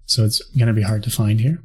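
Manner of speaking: ordinary talk, nothing marked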